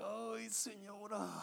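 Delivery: soft, slow, high "oh dear"; sympathetic sigh. Retold or imitated. Instructor answers the crying woman, softly drawn-out sympathy. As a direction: draw it out gently with pity.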